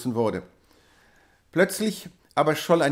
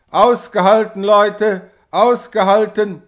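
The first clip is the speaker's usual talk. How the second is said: loud, high rallying shout; 6 semitones higher, 9 dB louder than usual. A narrator's reading. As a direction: urge the exhausted workers on at full voice. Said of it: "schrie er zu ihnen hinab". (laut anfeuernder Schrei)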